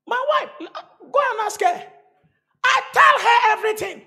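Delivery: very high, loud insistence; high-pitched emphatic outcry; shouted challenge. Real, not acted.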